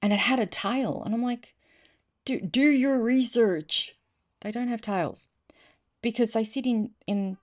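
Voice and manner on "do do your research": slow, higher-pitched mock telling-off; chiding, stretched-out retort; exasperated retort. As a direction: draw it out as a pointed correction.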